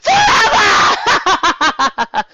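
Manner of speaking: very high, loud shrieking cackle; overdramatic evil laugh. A narrator's reading. Nightmare Moon triumphs, loud overdramatic evil cackle. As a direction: cackle over the thunder. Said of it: "Her overdramatic laughter".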